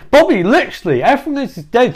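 high-pitched, agitated character voice; exasperated Yvonne. Acted. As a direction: pitch it up and plead the obvious. Outraged Yvonne confronts Bobby in story.

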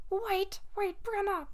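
high mock call of a friend; lightly voiced friend calling after the hang-up; imitating friend calling. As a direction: squeak her name like the friend. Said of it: "and then she's like".

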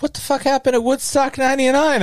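high, incredulous put-on voice of a friend; retold friend's baffled outburst, voiced high; imitating Ashley. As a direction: mimic her shocked question up high. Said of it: "And Ashley's like".

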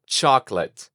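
"chocolate" as CHOK-let, two syllables; English pronunciation demo. Shown as a demonstration. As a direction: model English "chocolate" for a learner, second o dropped. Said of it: "Dilo conmigo". ("chocolate" en inglés, dos sílabas: chok-let)

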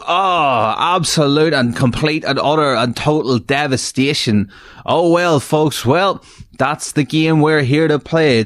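high, hammy mock devastation; game show host. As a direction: overplay the host's dismay.

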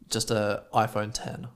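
normal talking voice

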